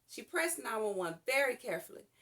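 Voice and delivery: usual speaking voice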